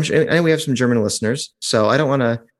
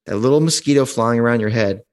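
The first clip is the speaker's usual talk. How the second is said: normal talking voice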